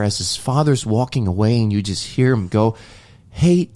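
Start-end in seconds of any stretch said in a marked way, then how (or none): 3.3-3.7 higher, hopeful call after him; calling out to dad. Retold or imitated. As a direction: call him back before he walks away. Son calls to departing father.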